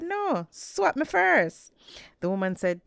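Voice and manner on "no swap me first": 1 stubborn insistence. A narrator's reading. Anansi insists in the haggle.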